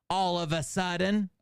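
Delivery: high, theatrical reading of the phrase; declaimed read-out. Acted. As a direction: declaim it like a line of verse.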